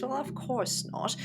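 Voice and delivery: usual speaking voice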